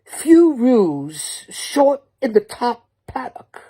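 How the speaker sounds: slow, high, hammed-up Aussie drawl; a loud, slow mock Aussie saying; mock Australian accent. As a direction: put on a cartoon Outback accent. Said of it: "impersonations, I can do it all".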